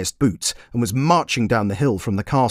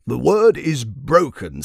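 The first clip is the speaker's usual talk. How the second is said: pompous king; 5 semitones higher, slower than usual. Acted.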